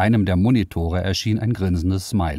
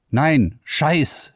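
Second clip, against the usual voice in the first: blurted curse; 9 semitones higher than usual. Acted. Liara rejects the AI's name, blurted curse. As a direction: blurt it out, higher and sharper. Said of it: "stieß sie aus".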